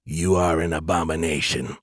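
low-pitched mechanical monotone; robotic voice. A narrator's reading. Elder AI condemns Skippy, robotic. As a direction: deliver the verdict like a machine, deep and even. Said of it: "came a robotic voice".

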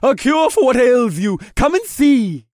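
high, loud merchant call; cheery vendor hawking wares; friendly shout. Acted. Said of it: "the vendor shouted in a friendly voice".

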